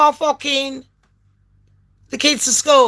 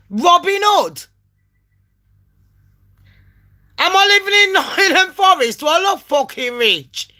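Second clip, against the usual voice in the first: indignant rant; 6 semitones higher than usual. Retold or imitated. Ranting at the agent.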